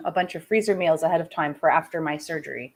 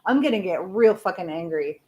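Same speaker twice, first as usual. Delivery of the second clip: ordinary talk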